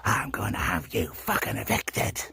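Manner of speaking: low, slow mock of her threat. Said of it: "She says".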